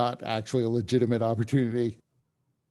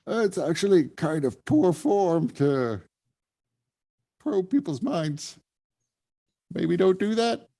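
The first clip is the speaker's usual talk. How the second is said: a hint of a higher, knowing innkeeper voice; innkeeper Brass; 6 semitones higher than usual. Innkeeper Brass leans in to warn the mind-probing player, lightly voiced higher. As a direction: lean in and gently warn them off.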